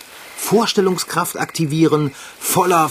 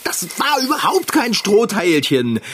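high panicky voice; frantic and disgusted; panicked; 8 semitones higher than usual. Acted. Panicked after creature on face.

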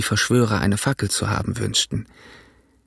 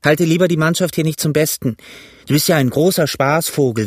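raised, higher voice of an annoyed pirate; indignant outburst in character; calling out; 5 semitones higher, 3 dB louder than usual. A narrator's reading. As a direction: tell him off in a raised voice. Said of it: "rief George".

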